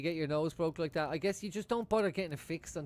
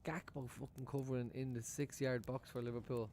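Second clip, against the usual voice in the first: ordinary talk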